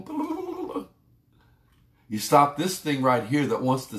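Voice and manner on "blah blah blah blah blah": high, soft mocking babble for the restless mind; tiny, much higher mock chatter, tossed off. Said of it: "this thinker up here that's going".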